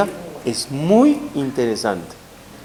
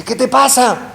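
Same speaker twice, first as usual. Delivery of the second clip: brother's rebuke; 10 semitones higher, 3 dB louder than usual. Retold or imitated.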